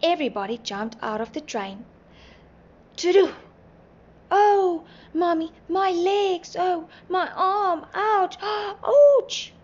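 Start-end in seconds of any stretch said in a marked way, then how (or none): 3.0-9.5 high, loud wails of hurt; high-pitched yelps and wailing; screaming in pain. A narrator's reading. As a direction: cry out as if badly hurt. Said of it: "Everybody was screaming".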